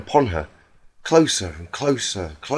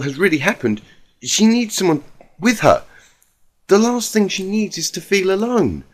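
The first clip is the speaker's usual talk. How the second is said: Rarity, earnest and worried; 7 semitones higher, 4 dB louder than usual. A narrator's reading. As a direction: plead gently but firmly for her friend.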